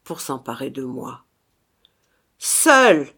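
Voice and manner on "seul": high, commanding shout; loud cry. A narrator's reading. Brother cries back at the phantom, loud and commanding. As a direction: cry it out to overrule him. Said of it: "s'écria à son tour Grégoriska".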